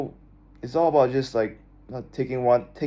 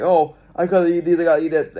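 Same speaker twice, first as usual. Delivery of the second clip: higher, louder mock fretting; stressed-out self; 5 semitones higher, 5 dB louder than usual. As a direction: play your old anxious self fussing over food. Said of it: "stressing out about everything".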